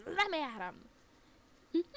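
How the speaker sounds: hammed-up howling fury; drawn-out mock rage. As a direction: bellow it as if held back by a harness. Dog's repeated outburst.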